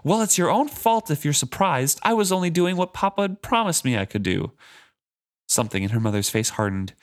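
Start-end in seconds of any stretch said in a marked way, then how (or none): 0.0-4.5 much higher, indignant shout; higher mock shout of a sulky girl. A narrator's reading. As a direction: shout back at your mother, indignant. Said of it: "Malta shouted".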